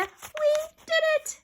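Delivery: high, slow triumphant cry; triumphant cheer. Acted. Alexia celebrating the first sale, high and slow. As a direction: stretch it out in triumph.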